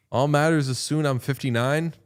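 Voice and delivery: usual speaking voice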